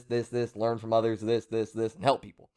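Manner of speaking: ordinary talk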